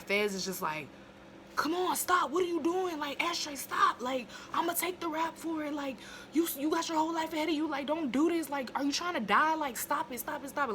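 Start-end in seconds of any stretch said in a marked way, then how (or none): 1.6-10.7 high, frantic pleading; frantic pleading Fez. Retold or imitated. Fez begging Ashtray not to fight, high frantic plea. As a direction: beg him to stop, voice pitched way up.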